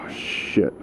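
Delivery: breathy whispered curse under a sigh. Real, not acted. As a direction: mutter it under your breath after a sigh. Sees a deer on the road.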